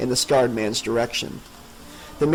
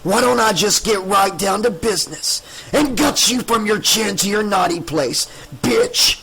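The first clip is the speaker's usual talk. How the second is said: menacing monster; 7 semitones higher than usual. A narrator's reading. Scarred man threatening to gut Tricia, eerie and sneering. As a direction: toy with her, then spit the last word.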